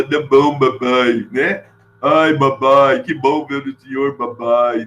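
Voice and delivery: lightly voiced boy with a Lebanese lilt, P said as B; mock the son greeting his father, babai; Lebanese-accented boy. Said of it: "falava o P como B".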